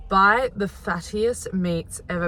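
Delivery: usual speaking voice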